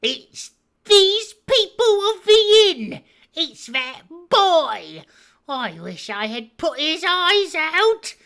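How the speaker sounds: very high, loud, slow vengeful rant; furious cry. Acted. Blind beggar raging at the innkeeper's boy, furious cry. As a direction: spit out the rage at the boy. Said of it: "cried the blind man".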